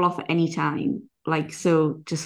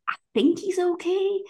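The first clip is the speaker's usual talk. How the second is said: high-pitched, tentative imitation; unsure seller; 10 semitones higher than usual. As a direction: lift it high, as if far from convinced. Voices seller unsure about the horse.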